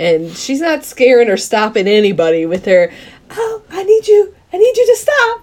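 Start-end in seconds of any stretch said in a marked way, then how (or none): 3.3-5.4 high-pitched feeble mock plea; imitating an ineffectual order to stop; unauthoritative. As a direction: plead helplessly in a high voice, poking fun at her. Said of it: "needs to be more authoritative".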